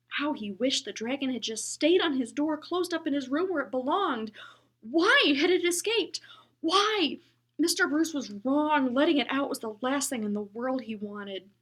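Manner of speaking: Henry's anguished questions, high and loud. A narrator's reading. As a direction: plead with the why. Henry's distress.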